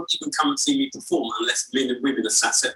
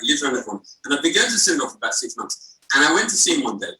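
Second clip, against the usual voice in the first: ordinary talk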